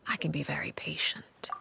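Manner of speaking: hushed, knowing whisper; a soft threat, meant to chill; menacing whisper. Acted. Menacing aside about children.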